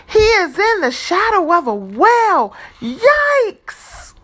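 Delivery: high, loud, fast, then a squeaky yikes; mock fright. A narrator's reading. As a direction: rush up to a frightened yelp. Whale shadow fright.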